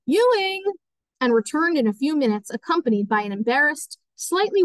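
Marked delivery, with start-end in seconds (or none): 0.1-0.6 high, sustained call of a name. A narrator's reading. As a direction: summon someone from another room. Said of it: "went out of the room calling".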